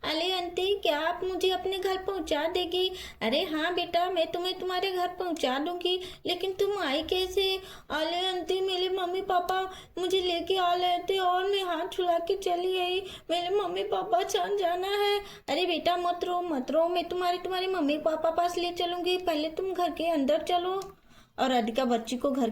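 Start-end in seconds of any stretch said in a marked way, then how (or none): 0.0-2.9 put-on lightly lisped baby-talk voice; little girl. Lost child asks aunty, baby talk. 3.2-7.5 higher-pitched storybook woman's voice; kindly Radhika. Acted. Radhika answers the child. 7.9-15.2 put-on lightly lisped baby-talk voice; little girl. Lost child explains in baby talk. 15.5-20.8 put-on higher-pitched storybook woman hushing a child; Radhika soothing, fretting.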